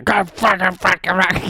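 rapid muttered-out swearing at a stuck job; dad cursing. Retold or imitated. Imitating the dad changing the tire, cursing. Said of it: "like the dad where he's out there changing".

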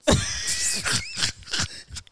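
high breathy wheeze of laughter; wheezing, high giggle fit; real laughter. Real, not acted. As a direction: crack up without words. Laughing at Spaniard remark.

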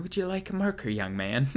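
put-on high, sweet old lady voice; sweet elderly poll worker. Elderly poll worker offering young voter a marker, high sweet voice. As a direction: coo it like a kindly grandma handing out ballots. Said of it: "elderly faces going".

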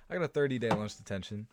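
usual speaking voice

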